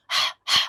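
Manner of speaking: হা as a whispered breath from the throat, like after spicy food; গলা থেকে শ্বাসের হা; breathy throat ha. Shown as a demonstration. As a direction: breathe হা out hard. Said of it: "একটা শব্দ হবে মুখের".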